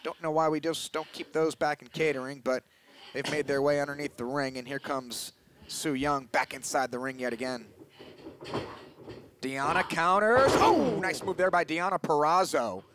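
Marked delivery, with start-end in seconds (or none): none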